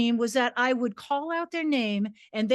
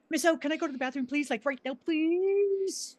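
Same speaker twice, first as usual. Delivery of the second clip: urgent student; 5 semitones higher, 5 dB quieter than usual. Retold or imitated. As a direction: beg to leave. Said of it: "Harry C".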